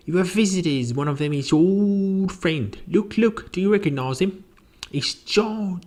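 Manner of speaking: high-pitched, rambling housekeeper; chattering housekeeper. Acted. Housekeeper reminding the professor of his visitor, high and chatty. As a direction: coax him brightly in a high voice.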